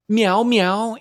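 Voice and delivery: high, loud meow meow from the cat. A narrator's reading. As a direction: meow sharply to cut the mice off. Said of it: "interrupted Simpkin".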